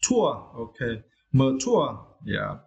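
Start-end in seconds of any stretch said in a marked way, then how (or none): 0.0-0.5 "tour" alone, punched high and falling; stressed second syllable. Shown as a demonstration. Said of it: "you need to stress the second syllable". 1.3-2.1 "mature" drawn out with the weight on "ture"; teacher's model of "mature", ma-TURE; stress on second syllable. Shown as a demonstration. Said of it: "you need to stress the second syllable".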